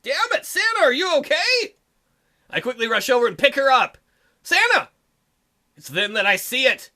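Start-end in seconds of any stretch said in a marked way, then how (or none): 0.0-1.8 alarmed. Acted. Koji alarmed. 4.4-4.9 much higher shout of the name; calling out. Acted. Calling out to Santa.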